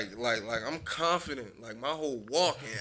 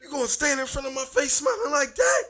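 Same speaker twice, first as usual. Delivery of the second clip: higher and louder scolding; angry mom quote; 12 semitones higher, 6 dB louder than usual. Retold or imitated. Quoting angry mother.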